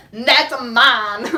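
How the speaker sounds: loud, mock-proud claim; hammed-up bragging; proud boast. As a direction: claim it loudly, showing off. Calling out ownership of elk.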